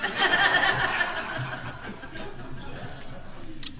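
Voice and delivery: a long, high, breathy burst of laughter; big laugh. Real, not acted. Laughing at her own joke before the group. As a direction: laugh hard at your own punchline.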